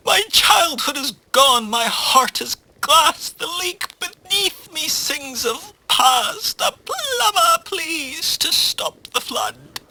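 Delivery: high, wailing tragic poet; melodramatic tsunami. Acted. Tsunami pleading with a plumber, melodramatic wail. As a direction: lament in a high, theatrical voice.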